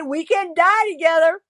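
mock-cheery high line; high mock cheer. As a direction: deliver the punchline high. Joking punchline quote.

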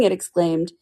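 plain reading voice

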